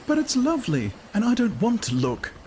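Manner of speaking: high, bright woman's voice, upset; a woman pleading against the silence; the radio was lovely, high and anxious; Lenina's voice. A narrator's reading. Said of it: "Lenina answers Bernard".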